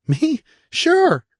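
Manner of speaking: put-on high, bright-eyed kid whooping then chatting; little brother bragging about his fall; cheerful little boy. Eric voiced as a boy.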